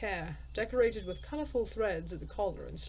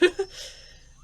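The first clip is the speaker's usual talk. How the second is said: high breathy laugh; 11 semitones higher than usual. Real, not acted. Laughs at own misreading.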